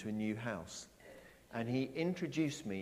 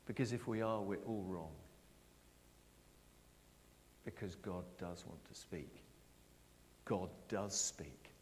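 ordinary delivery

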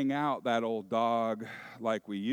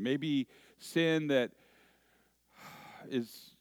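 ordinary delivery

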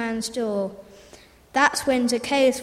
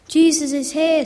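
loud, excited call; a townsperson shouts that Jesus has arrived; shouted announcement; 4 semitones higher, 6 dB louder than usual. A narrator's reading. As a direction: cry the news across the crowd. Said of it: "somebody shouted".

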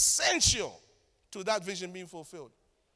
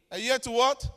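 rousing call for response; 3 semitones higher, 8 dB louder than usual. Real, not acted. Preacher prompting the congregation to answer, rousing call. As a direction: throw it out loudly for the crowd to finish.

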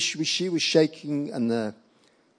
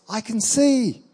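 high, astonished outburst; raised pitch, joyful mock exclamation; retold amazed cry; 9 semitones higher, 4 dB louder, slower than usual. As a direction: imitate her amazed discovery. Woman discovers she can see.

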